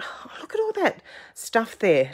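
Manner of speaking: excited little whisper. Retold or imitated. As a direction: whisper it like a delighted secret. Excited thought to herself.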